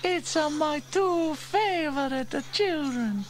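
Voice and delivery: high, slow character voice, fond; high-pitched affectionate greeting; Bella delighted. A narrator's reading. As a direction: gush over the children with a big smile. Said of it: "with a great big smile".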